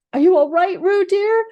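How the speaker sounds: high, loud worried call; anxious call. A narrator's reading. Kanga calling anxiously to Roo in the stream. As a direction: call out to your child in the water, anxious. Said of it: "called Kanga anxiously".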